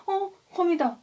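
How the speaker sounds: startled by a spider. Retold or imitated. Big Hugh spotting a spider, startled. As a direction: jump at the spider. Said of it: "깜짝 놀래서".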